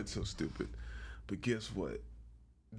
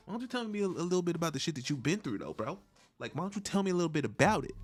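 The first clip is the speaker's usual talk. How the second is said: much higher and louder, rushed interviewer; higher Nardwuar voice; 6 semitones higher, 5 dB louder, faster than usual. Pretend. Eager interviewer pressing a guest, high and fast. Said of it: "he was a little bit higher".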